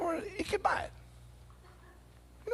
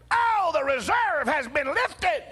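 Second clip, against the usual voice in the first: auctioneer announcement; 9 semitones higher, slower than usual. Retold or imitated. Auctioneer telling the room the car will sell. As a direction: proclaim it to the whole crowd.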